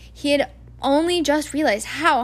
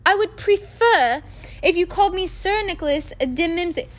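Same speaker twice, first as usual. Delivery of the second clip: stiffly; 7 semitones higher, 3 dB louder than usual. A narrator's reading. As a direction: correct them with offended dignity. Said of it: "the ghost began stiffly".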